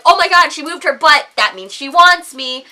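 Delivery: mock an over-eager man, loudly; deluded guy.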